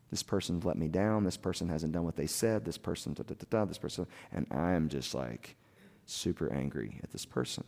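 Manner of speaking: soft, low venting, held in; retold muttered list of complaints; low grumbled grievances. Husband listing to his wife who let him down, low grumble.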